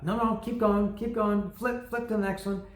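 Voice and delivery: will him to keep flipping; inner urging. Retold or imitated. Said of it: "I thought".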